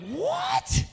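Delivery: high shrieked what, loud; a stunned scream of what; shocked what. Retold or imitated. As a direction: squeal it in total disbelief. Shocked at woman walking.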